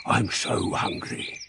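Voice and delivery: slow, soft whispered wolf thought; hungry wolf whisper. Acted. Wolf alone with the basket, slow hungry whisper. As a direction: sigh it out like a starving wolf.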